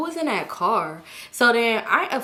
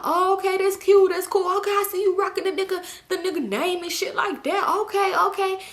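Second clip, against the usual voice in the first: mock Woe teasing; 10 semitones higher than usual. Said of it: "He like".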